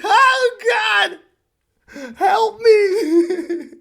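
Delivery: high, loud, laughing cry for help; mock terror, flailing; hammed-up panic.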